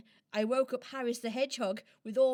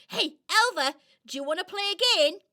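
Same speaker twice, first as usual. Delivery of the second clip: eager squirrel; 7 semitones higher, 6 dB louder than usual. Acted.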